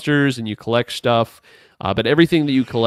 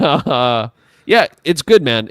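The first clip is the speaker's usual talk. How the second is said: laughing; 4 semitones higher, 8 dB louder, slower than usual. Real, not acted. Laughing after describing funny game.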